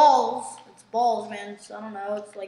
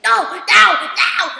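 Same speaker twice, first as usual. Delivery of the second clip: loud, drawn-out mock protest; emphatic refusal; 4 semitones higher, 11 dB louder, much slower than usual. Friend refusing to hear of the prank, loud mock protest. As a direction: cry out the refusal, higher and much louder.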